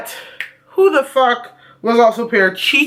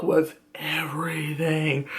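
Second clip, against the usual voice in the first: soft whispered aside; hushed whisper; 7 semitones lower, 10 dB quieter, slower than usual. Real, not acted. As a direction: breathe it out like a secret.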